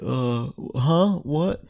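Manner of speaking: soft, hesitant mock confusion; flustered. Someone who had seen him before answers, flustered. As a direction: act caught off guard, questions rising. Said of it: "Someone that may have seen him".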